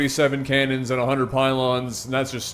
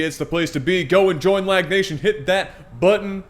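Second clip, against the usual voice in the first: announcer intro; 5 semitones higher, 3 dB louder than usual. Acted.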